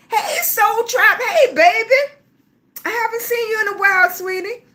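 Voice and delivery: high, delighted hello to a viewer; excited greeting. Real, not acted. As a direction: sing out a happy welcome.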